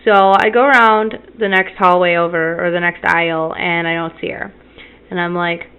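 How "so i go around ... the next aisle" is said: normal talking voice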